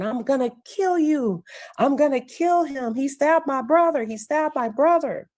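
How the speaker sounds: high-pitched mock outcry; imitated frantic threat. Kid running at the coach over stabbed brother, imitated frantic threat. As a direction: act out a kid crying for revenge, not shouted. Acted cry of a distraught kid.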